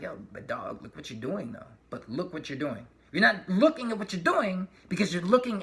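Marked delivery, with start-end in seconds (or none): 0.0-2.9 much softer, lower pleading; hushed heartfelt plea; soft voice of the heart. Pretend. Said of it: "your heart's like".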